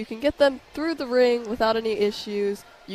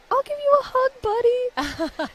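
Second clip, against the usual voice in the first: put-on much higher, sing-song sweetness to a little boy; sweet to a kid; 4 dB louder, slower than usual. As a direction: coo it like talking to a small child. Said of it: "I was like".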